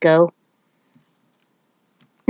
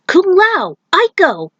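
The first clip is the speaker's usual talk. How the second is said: calling out; 11 semitones higher than usual. Acted. Calling out greeting.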